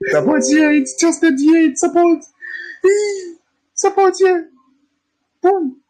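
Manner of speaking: very high squeaky mock voice; mock excitement. Teacher mimicking trendline traders spotting support, high mock excitement. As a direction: squeal it like an over-eager chart watcher.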